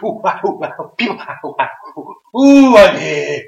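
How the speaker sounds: high, bouncing, slowing out at the end; mock hyperactive Enzo bouncing around; hyper Enzo. Enzo bouncing off the walls on entry, hyper. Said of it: "he's like Speedy Gonzalez".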